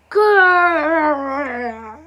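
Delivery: loud growling grrr; a rumbling, playful dinosaur growl; toy dinosaur growl. Acted.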